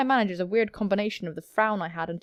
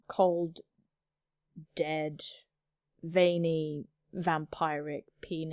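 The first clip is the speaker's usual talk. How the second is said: low deadpan aside; 6 semitones lower, 6 dB quieter, much slower than usual. Acted.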